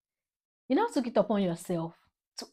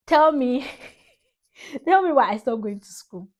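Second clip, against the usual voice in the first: laughing; 6 semitones higher, 10 dB louder than usual. Real, not acted. Laughing challenge to viewers.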